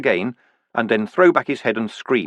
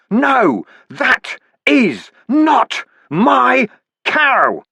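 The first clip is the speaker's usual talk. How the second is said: much higher, loud, very slow howl; anguished scream; 13 semitones higher, 5 dB louder, much slower than usual. Acted. Vimes on his knees howling at the heavens, stretched out. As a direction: howl it to the gods in torment. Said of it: "and screamed".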